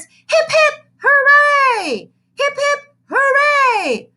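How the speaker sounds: very high loud cheer; a classroom cheering. Acted. Kindergartners cheer for the boy, loud. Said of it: "chimed the kindergartners".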